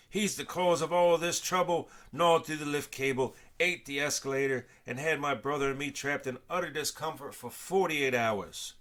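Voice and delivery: outraged man blaming the raven loudly; angry rant. A narrator's reading. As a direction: rant about the bird's crimes. Angry Mr Round ranting.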